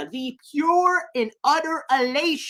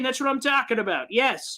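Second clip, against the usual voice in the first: usual speaking voice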